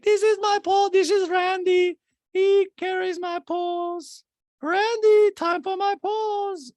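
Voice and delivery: very high, loud, drawn-out diva voice; hammed-up rich lady. Podcaster playing Diana with her pole carrier, mock diva. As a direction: play a pampered madam summoning staff.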